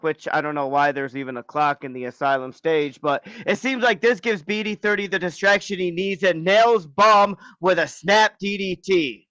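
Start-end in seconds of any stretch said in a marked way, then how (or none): 3.0-9.2 higher, driving match narration; wrestling commentary. Acted. Co-host narrating a wrestling match to listeners, commentary style. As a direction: call the action like a ring announcer.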